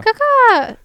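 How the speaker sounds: loud, high caw; hawk screech. Acted. Hawk noise segment, answering call.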